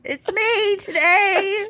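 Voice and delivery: high, slow, laughing through it; laugh-crying. Real, not acted. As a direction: wail it while laughing. Laughing about her bad day.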